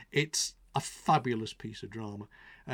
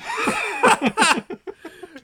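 loud, high burst of laughter; real high-pitched guffaw; big laugh; 13 dB louder than usual. Real, not acted. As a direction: burst out laughing after a rant. Bursts out laughing after rant.

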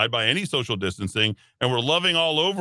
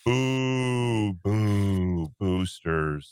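playful booing; 2 semitones lower, much slower than usual. Pretend. Host playing on the word boosters for listeners, playful booing. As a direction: stretch it out like a mock boo.

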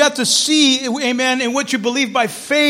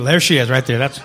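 louder, lower mock of a man spotting his girl; eager single guy; 8 semitones lower, 6 dB louder than usual. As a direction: play the smitten single man, loud. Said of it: "He's like".

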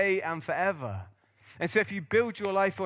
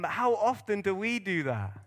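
normal talking voice

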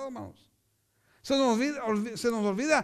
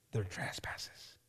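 hushed close; 12 semitones lower, 14 dB quieter, much slower than usual. Acted. Closing line of the reading.

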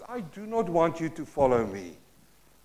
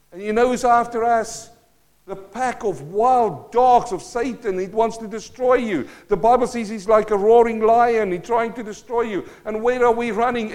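loud, driving sermon delivery, building urgency; preaching at full force; 4 semitones higher, 6 dB louder than usual. Acted. Pastor urging the congregation about Satan's pursuit, in full preaching voice. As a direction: preach it hard, pushing each line to the room.

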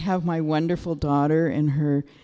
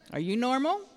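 ordinary talk

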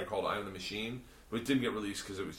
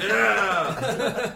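high, loud drawn-out cheer; mock cheering yell; 9 semitones higher, 9 dB louder than usual. Him answering his drinking buddies, loud cheering yell. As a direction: holler it back to the crowd.

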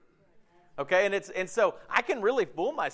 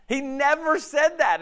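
much higher and louder, slow, driving the point home; emphatic denial; 10 semitones higher, 7 dB louder, slower than usual. Real, not acted. Preacher to congregation, slow emphatic denial. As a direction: hammer out the denial slowly. Preacher answering his own question.

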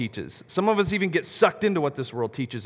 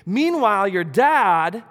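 ordinary delivery

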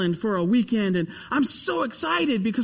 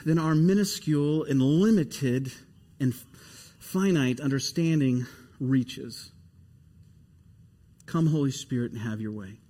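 prayer; 8 semitones lower than usual. Acted. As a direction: pray it reverently. Pastor leads prayer.

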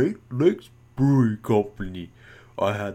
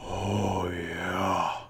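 hushed reverent; 6 semitones lower, 8 dB quieter than usual. Acted. Drops voice after high squeal.

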